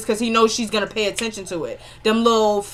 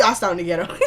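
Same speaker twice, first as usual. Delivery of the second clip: usual speaking voice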